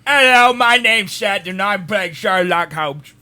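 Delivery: slow, loud, hammed-up fake British accent; bad British accent. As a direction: overdo a terrible British accent, slowly. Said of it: "trying to do British".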